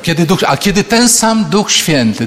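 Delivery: ordinary talk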